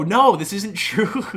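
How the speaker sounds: loud, high, drawn-out protest; mock anguished denial. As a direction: wail it in mock disbelief, breaking into laughter. Acts out dream denial, breaks laughing.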